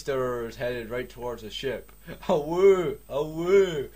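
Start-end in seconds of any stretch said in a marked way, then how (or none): 2.3-3.9 loud high wailing siren "a woo"; alarm siren imitation. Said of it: "Went the alarm".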